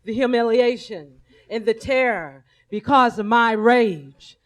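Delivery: ordinary talk, nothing marked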